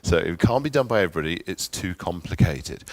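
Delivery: ordinary delivery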